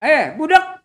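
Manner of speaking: loud high call after someone walking away; loud rude call from behind. Acted. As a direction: shout to get his attention. Stranger calls after Dulah.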